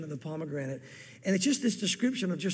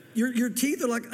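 breaking into giggles mid-verse; stifled laughter cracking through; trying not to laugh; 4 semitones higher than usual. Real, not acted. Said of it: "I'm trying not to laugh".

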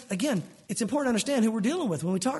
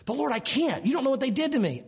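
higher, whiny plea to the Lord; pleading believer; 6 semitones higher than usual. Pretend. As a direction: protest to God like a hurt child.

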